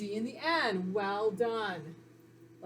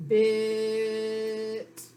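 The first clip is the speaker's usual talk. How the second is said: BIT with the vowel stretched out in one long hold; blended and held. Shown as a demonstration. Teacher shows pupils how to blend sounds, blended and held. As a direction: draw out the i in BIT on a steady pitch. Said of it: "Blend and hold".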